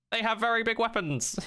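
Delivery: lightly played cute robot. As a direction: chirp it like a little robot. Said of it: "in, like, a robot-y voice".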